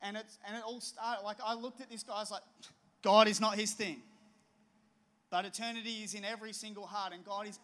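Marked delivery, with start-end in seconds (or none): 3.0-4.0 much louder, emphatic dismissal; a loud, mocking snap judgment; blunt judgment. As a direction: bark it out as a sure verdict. Retold judgment of the man.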